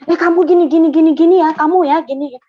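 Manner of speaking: high, loud mock telling-off; imitated scolding. Believer mimics how they used to accuse people, loud scolding. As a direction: imitate yourself loudly pointing the finger at someone. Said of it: "dulu saya suka gini".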